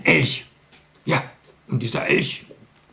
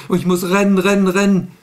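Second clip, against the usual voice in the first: panicky running; 6 semitones higher, 5 dB louder, faster than usual. Acted. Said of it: "Da sagt der Elch".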